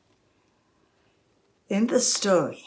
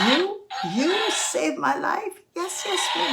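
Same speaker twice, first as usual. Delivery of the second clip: incredulous lion; 10 semitones higher than usual. Acted. Lion mocking the mouse's promise, incredulous. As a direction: echo the offer in high-pitched astonishment.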